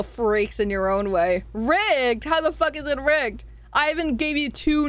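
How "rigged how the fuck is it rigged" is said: usual speaking voice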